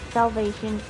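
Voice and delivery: ordinary delivery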